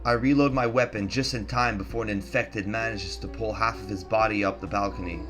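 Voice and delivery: usual speaking voice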